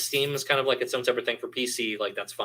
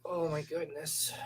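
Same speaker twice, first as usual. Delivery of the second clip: soft, high pet-talk coo; cooing to cat; 6 semitones higher, 6 dB quieter, slower than usual. Real, not acted. Owner fussing over big kitty, cooing.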